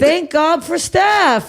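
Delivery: thrilled shout of thanks; overjoyed. Real, not acted. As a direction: stretch it out in happy disbelief. Reading nice comments, overjoyed.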